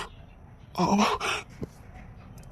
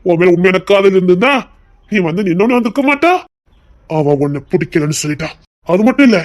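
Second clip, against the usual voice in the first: usual speaking voice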